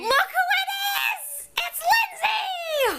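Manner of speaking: high excited greeting shout. Real, not acted. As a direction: call out her name, thrilled. Greeting friend on camera excitedly.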